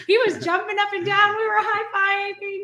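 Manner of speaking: high, joyful godson cheer; excited retelling. Retold or imitated. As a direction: relive a kid's triumph.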